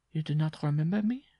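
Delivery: high-pitched character voice; questioning visitor. A narrator's reading. Gaunt woman to bareback rider, high questioning. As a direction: ask it up high, rising at the end.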